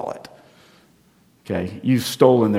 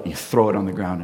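ordinary delivery

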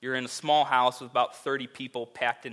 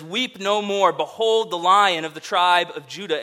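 loud, ringing proclamation; proclaiming cry; 3 semitones higher, 7 dB louder than usual. Acted. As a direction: announce it like a herald from heaven. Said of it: "a voice cries out".

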